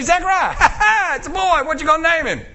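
calling out to Zachariah, laughing; excited relatives. Acted. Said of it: "come to Zachariah and say".

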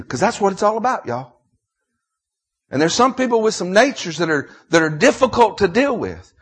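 loud, high Southern pulpit preaching; full-force sermon delivery; impassioned preaching. Real, not acted. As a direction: preach it out high and hard, leaning on key words.

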